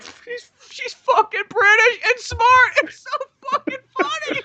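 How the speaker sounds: very high, slow, giggly mock director; giddy Michael Bay. Imagining Michael Bay cackling at his own film. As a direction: squeal it like a delighted kid. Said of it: "probably Michael Bay's like".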